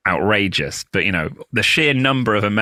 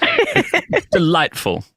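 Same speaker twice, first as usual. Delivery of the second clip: slow, plummy upper-class English; posh British; 8 semitones higher, much slower than usual. Shown as a demonstration. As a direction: drawl it out terribly posh. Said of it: "I just do this".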